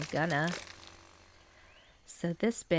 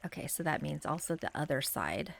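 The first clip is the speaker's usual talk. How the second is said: whispering; 4 semitones lower, 6 dB quieter than usual. Real, not acted. Cozy game vlog, soft aside.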